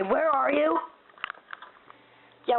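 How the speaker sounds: normal talking voice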